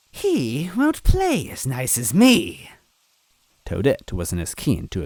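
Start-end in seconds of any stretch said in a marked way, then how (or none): 0.1-2.7 high, slow threat; menacing witch. A narrator's reading. Villain warning about Smithy, slow high witch voice. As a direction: let the warning hang.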